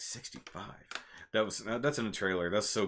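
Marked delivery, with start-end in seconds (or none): 0.0-0.9 very soft, whispered echo with a rise; whispered retold question. Character retold echoing the size in the argument, whispered question.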